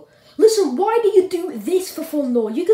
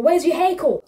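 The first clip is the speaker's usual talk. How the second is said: normal talking voice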